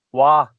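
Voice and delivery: "wa" for wall, with no l; Fife dialect. Shown as a demonstration. Friend showing how Fife folk say wall as "wa". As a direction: say "wa" with the l dropped. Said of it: "for wall, they say wa".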